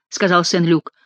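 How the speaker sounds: ordinary delivery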